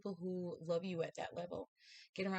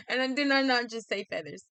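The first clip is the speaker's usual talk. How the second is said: excited; 6 semitones higher, 11 dB louder than usual. Real, not acted. Her prediction confirmed by the card.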